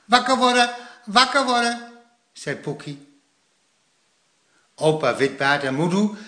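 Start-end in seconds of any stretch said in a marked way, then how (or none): 0.0-1.9 put-on high child's echoing call; little brother calling. A narrator's reading. Poekie waking grandpa and grandma, little child calling. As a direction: echo the wake-up call in a small high voice.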